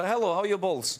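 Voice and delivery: put-on high-pitched comic Russian accent; jokey mock-Russian greeting; funny Russian accent. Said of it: "in a funny Russian accent".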